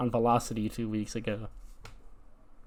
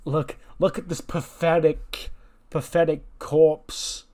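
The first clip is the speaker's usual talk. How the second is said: slow, drawn-out heel promo sneer; scornful taunt; 5 semitones higher, slower than usual. Acted. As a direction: dwell contemptuously on each insult. [name] taunting the rival faction.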